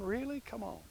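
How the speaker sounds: can't believe she did that; exasperated disbelief. Real, not acted. Exasperated at dog.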